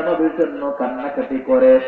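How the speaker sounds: ordinary talk, nothing marked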